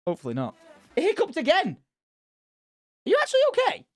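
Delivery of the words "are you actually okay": much higher, fussing over the pet; high pet talk. Real, not acted. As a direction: coo anxiously at the pet. Worried talk to sick pet.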